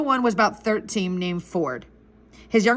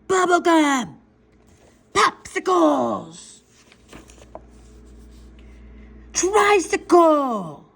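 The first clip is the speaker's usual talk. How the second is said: put-on high, drawn-out kid's yell; yelling demands; 6 semitones higher, much slower than usual. Boy shouting at tower guards for goodies, yelling. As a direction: call out each treat like a shouted order. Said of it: "So I yelled".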